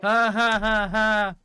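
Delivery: loud, slow belly laugh; hearty laughter. Real, not acted. Laughs hard at the video joke.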